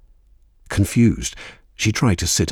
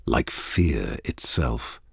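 ordinary delivery, nothing marked

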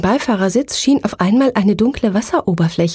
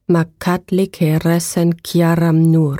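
slow, low, hoarse ancient incantation; hoarse eerie voice; 3 semitones lower, slower than usual. Acted. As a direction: intone it like an ancient being. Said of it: "Eine heisere Stimme wiederholte".

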